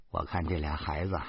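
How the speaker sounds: usual speaking voice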